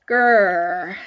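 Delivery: low, loud creature growl. A narrator's reading. Fing growls at the snot-covered crowd. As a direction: growl deep like a cornered beast. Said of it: "growled Fing".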